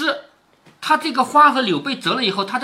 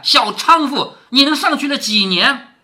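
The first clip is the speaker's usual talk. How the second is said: higher, louder narrated scolding; acted abusive outburst, moderately forceful; cursing; 5 semitones higher, 4 dB louder, slower than usual. A narrator's reading. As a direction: read it as a mother berating her daughter. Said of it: "骂着说".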